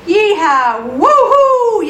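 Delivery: high, loud whooping cheer; yelling. A narrator's reading. Friends celebrating the healed man, yelled whoops. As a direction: whoop with joy, stretching the last word. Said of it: "yelled the friends".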